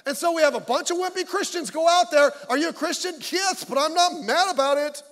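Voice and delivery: fired-up shouting; heated preaching rant. Real, not acted. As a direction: belt it out at the congregation. Heated rant, then apologises.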